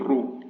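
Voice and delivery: "ru" with the u darkened by the r; emphatic r. Shown as a demonstration. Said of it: "a bit darker and a bit lower in the throat".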